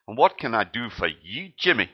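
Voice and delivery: Scottish medic, hammed up badly; bad Scottish accent. Gordon medic greets a sheepish Joey, bad Scottish accent. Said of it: "My Scottish accent is rubbish".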